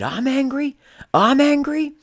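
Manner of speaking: very high, incredulous, building to loud; escalating angry retort. Retold or imitated. Knife-wielding man answering third apology, escalating angry retort.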